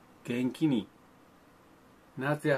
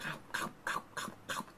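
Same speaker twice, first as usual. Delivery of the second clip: slurping noise; 7 dB quieter, much faster than usual. Pretend. Eating watermelon.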